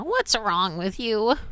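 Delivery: high, slow sneering flower; Flowey voice. Retold or imitated. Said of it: "he's all, you know".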